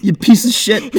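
loud, high Boston shout through laughter; bad Boston accent. Shown as a demonstration. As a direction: shout it as a Boston bro, cracking up. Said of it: "I gotta get, like, into character".

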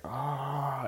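high pained cry, mildly done; retold scream. Jack screaming as helicopters shoot Kong, a high mock cry. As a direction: let out a short mock scream. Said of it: "and he's just screaming".

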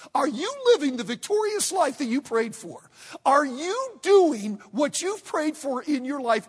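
loud, driving sermon voice; loud revival preaching; fiery preaching. Real, not acted. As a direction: preach it hard at the congregation. Preaching at full force.

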